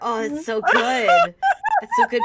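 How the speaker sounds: slow, high, loud delight at the roll; drawn-out squeal of joy; thrilled. Real, not acted. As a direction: crow over a great result. Thrilled at a good dice roll.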